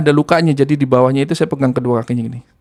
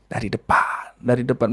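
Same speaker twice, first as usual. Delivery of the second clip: low unvoiced whisper; jinn whisper; 5 semitones lower, 7 dB quieter than usual. Jinn whispering to the healer where it entered, low eerie whisper. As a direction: breathe the answer like a hidden spirit. Jinn points to front door.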